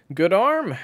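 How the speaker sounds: high, loud field call; called-out praise. A narrator's reading. Coach praises the boy's throw, loud calling out. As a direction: call it out loudly to the player.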